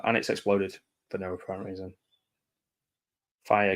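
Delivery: ordinary talk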